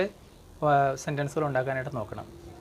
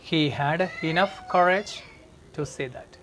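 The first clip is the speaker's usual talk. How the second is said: slow model sentence; much slower than usual. Shown as a demonstration. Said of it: "teacher reads model sentence".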